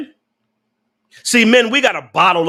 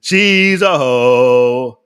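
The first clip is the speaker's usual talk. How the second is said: drawn out very slowly and lower; slow emphatic verdict; 6 semitones lower, 3 dB louder, much slower than usual. Acted. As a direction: stretch every word like a final ruling.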